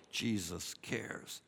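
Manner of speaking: very soft, low and slow; tender reassurance. Real, not acted. Preacher to those going through sorrow, hushed tender assurance. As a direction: let it land as a hushed comfort. Comfort after listing loss and sorrow.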